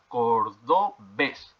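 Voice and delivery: cordobés very slowly, syllable by syllable, landing on BÉS; drawn-out cor... do... BÉS, as a teacher demonstrates; stress on last syllable. Shown as a demonstration. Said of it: "tiene el acento en la última sílaba".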